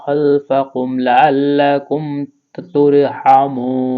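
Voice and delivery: slow, chanted tilawat of the full verse; Quran recitation. Acted. Teacher reciting the finished verse for viewers, slow chanted tilawat. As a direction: recite the whole verse slowly with melodic devotion.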